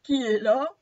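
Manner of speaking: loud, lightly voiced quavering wolf; a hint of quavering old voice. Wolf in Grandmother's bed asks who knocks, mildly quavering voice. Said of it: "demande le loup d'une voix chevrotante".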